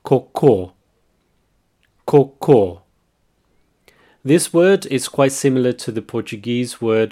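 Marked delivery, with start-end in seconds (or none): 0.0-2.8 cocô, stress on the final syllable, both O's the same; cocô with a closed O in both syllables; stress on the end. Shown as a demonstration. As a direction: say cocô with the weight on the last syllable. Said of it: "the stress is at the end".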